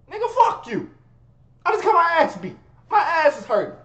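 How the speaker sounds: high, loud angry yell; furious outburst. Retold or imitated. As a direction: lash out in pain.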